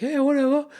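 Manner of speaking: high, breezy brush-off; dismissive whatever. Retold or imitated. Mates brushing off the girl leaving, breezy. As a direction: wave it off carelessly. Mock dismissive reply to friend.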